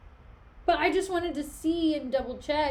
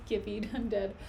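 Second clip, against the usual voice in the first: usual speaking voice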